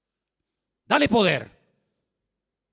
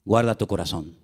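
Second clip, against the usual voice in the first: solemn recitation; 9 semitones lower than usual. Real, not acted. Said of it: "dice la Biblia". (cita bíblica lenta y solemne)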